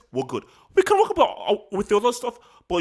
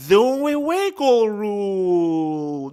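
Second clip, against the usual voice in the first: loud, very slow, stretched-out words; drawn-out exclamation; 2 semitones higher, 6 dB louder, much slower than usual. Real, not acted. Analyst recalling a rule change, drawn out loudly. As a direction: stretch every word out loudly.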